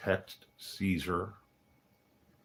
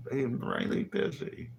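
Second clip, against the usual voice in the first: high, louder playful dismissal; mock brush-off; 7 semitones higher, 4 dB louder than usual. Brushing off a pestering friend, high and playful. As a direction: shoo them off, teasing.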